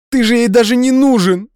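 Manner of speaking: high, loud cutting yell; shouted out. Acted. Nadya yelling to hurt Dmitry, high loud shout. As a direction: shout it to wound him. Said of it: "выкрикнула Надя".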